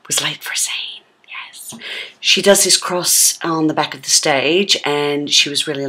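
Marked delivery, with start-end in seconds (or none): 0.1-1.7 whisper. Real, not acted. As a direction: lean in and whisper the gossip. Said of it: "conspiratorial aside about Frank".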